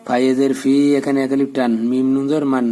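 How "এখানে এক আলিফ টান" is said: usual speaking voice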